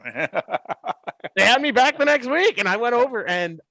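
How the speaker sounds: high, drawn-out words through laughter; laughing while talking. Real, not acted. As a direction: keep telling it through a laugh. Laughing while telling the story.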